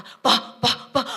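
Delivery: breathy, barely voiced calling to father; forcing out the words in a whisper; strained whisper. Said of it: "Ayo ngomong papa". (berbisik tertahan memanggil papa)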